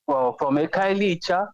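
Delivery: normal talking voice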